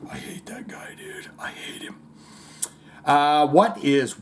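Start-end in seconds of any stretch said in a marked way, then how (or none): 0.1-2.0 barely audible mock whisper; whispered resentment. Coworkers grumbling about the joke teller, hushed mock whisper. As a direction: whisper it under your breath, as behind someone's back.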